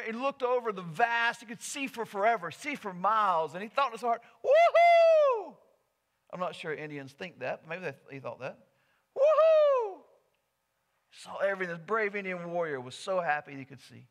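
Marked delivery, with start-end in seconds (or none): 4.4-5.6 loud, very high whoop of triumph; joyful whoop. Acted. Warrior cheers on mountain peak. 9.2-10.0 loud, very high whoop of triumph; joyful whoop. Acted. Warrior cheers on mountain peak.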